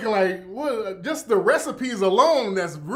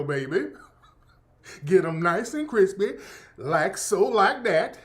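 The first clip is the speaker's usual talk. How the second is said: exaggerated Southern accent. Streamer mimicking a cooking influencer, exaggerated Southern accent. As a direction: lay the Southern accent on thick, like a TikTok cook. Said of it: "She's going overboard with the accent".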